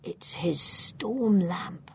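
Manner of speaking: slow, toneless whisper; flat whisper. A narrator's reading. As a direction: whisper it slowly, without expression. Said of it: "whispers flatly".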